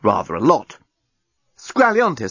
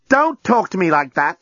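high-pitched indignant retort; firing back; 7 semitones higher than usual. A narrator's reading. Lucy objects to journalist's rudeness, indignant retort. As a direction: fire it back, offended. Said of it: "fired Lucy".

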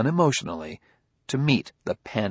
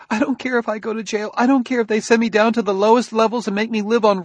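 frantic pleading; 12 semitones higher, faster than usual. A narrator's reading.

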